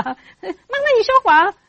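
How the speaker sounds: put-on very high, loud child's accusation; accusing child. Child catches mum in a lie, high loud accusing. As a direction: pitch it way up like an indignant kid.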